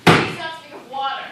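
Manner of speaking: loud desperate plea; loud begging outburst; pleading cry. Acted. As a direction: cry at him to stop. Woman begs him stop.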